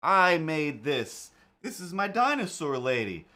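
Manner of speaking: loud high no, then slow insistence; indignant protest. Retold or imitated. Retelling answering a fan about her shirt, loud and indignant. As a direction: defend your own work. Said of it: "I'm like".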